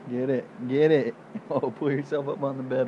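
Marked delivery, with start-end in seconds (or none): none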